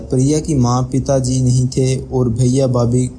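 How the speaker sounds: usual speaking voice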